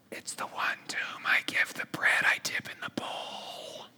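slow, secret whisper; Jesus whispering the answer to John. Retold or imitated.

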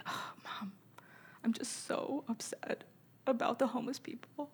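put-on breathy whisper of a worried little girl; upset daughter. Mom imitates her upset daughter, whispering.

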